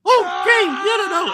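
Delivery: very high, loud giddy no no no; squealing playful refusal; shrill giddy protest. Retold or imitated. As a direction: wave him off in a squeal. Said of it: "and then she's like".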